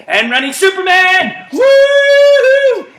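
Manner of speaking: very high, loud sung whoop; excited whoop. Real, not acted. Coach calling Superman mid-run: high sung whoop. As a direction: soar on the woo.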